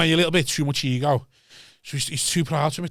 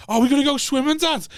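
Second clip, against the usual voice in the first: put-on hopeful child; 10 semitones higher than usual. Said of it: "some eight-year-old kid going".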